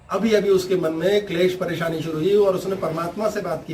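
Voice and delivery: usual speaking voice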